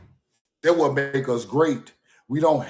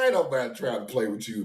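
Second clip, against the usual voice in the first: usual speaking voice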